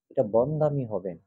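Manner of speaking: ordinary talk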